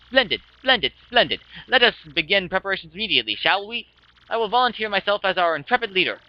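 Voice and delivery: grandiose, theatrical, self-important adventurer; boastful Flavio. Acted. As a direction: proclaim it with swelling pride and relish. Plays Flavio boasting of his ship.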